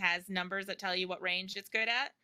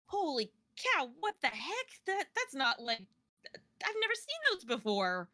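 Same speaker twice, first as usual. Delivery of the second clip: higher-pitched, acted disbelief; startled athlete; 6 semitones higher than usual. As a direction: react as a character baffled by strange creatures. Game master voices startled athlete.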